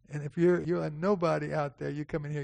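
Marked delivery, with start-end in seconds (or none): none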